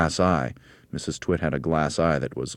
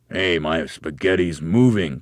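loud, slow, puzzled exclamation; startled cry; 5 dB louder, slower than usual. A narrator's reading. Mr. Twit sees his spaghetti move, startled cry. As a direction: cry out in drawn-out surprise. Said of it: "cried Mister Twit".

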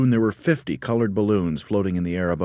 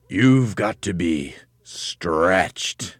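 very drawn out, relishing it; slow sinister reveal; 2 semitones higher, 4 dB louder, much slower than usual. A narrator's reading. Husband revealing the cure to his wife, slow ominous voice. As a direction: stretch the last word right out.

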